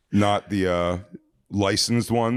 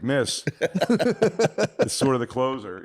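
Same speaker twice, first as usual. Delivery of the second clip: polite plea; 6 semitones higher, much slower than usual. Retold or imitated. Pleading with mom as Elmo.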